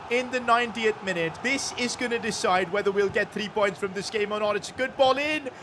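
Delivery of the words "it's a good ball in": ordinary talk, nothing marked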